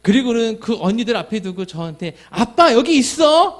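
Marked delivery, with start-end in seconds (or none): 2.3-3.6 put-on loud, high, slow little girl's call; little daughter proudly calling her dad; child calling dad. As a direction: call out like a five-year-old.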